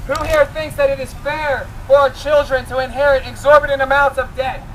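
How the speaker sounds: ordinary delivery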